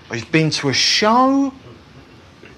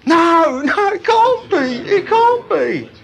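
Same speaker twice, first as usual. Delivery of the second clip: mock British disbelief; 8 semitones higher than usual. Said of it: "being Brits, we're all going".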